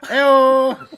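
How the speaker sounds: very high, very loud "Eyo"; annoying alarm call. Pretend. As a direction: blare "Eyo" like a wake-up alarm. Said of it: "What an annoying way to wake up".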